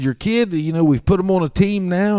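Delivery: grab their attention quickly; coach announcement. Retold or imitated. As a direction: coach cutting off questions. Coach speech.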